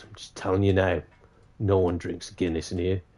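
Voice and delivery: low, quiet hint of a barman; understated landlord's warning, dropped in pitch and volume. Barman telling a customer about the Guinness, quietly warning.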